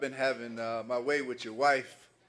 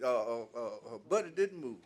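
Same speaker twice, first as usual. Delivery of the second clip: ordinary talk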